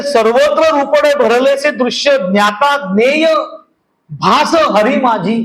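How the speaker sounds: slow, measured abhang recital; devotional verse recitation. Acted. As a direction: recite the verse slowly, drawing out each phrase.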